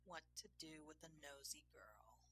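softly musing, half to himself. A narrator's reading. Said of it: "he mused".

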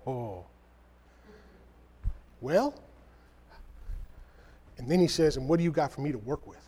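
low, soft, hesitant reply; hesitant mumble. Retold or imitated. Believer answering God, sheepish and quiet. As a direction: hem and haw like you've got nothing.

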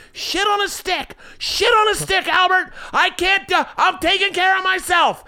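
very high, wailing imitation of mom; hammed-up queasy mother fending for herself; motion-sick mom. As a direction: squeal like a mom enduring the ride. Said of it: "My mom gets motion sick".

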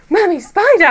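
put-on high, loud kid's cry of alarm; child's alarm. Daughter shouting a warning from the back seat, high and loud. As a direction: call it out like a startled little girl.

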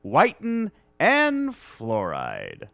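slow announcer sponsor plug; booming commercial voiceover; ad read voice. Pretend. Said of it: "that's pretty good".

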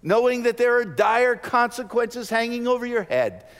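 high, loud warning; fervent loud sermon line; loud preaching. Acted. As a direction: warn them of the dire consequences. Sermon rise on consequences.

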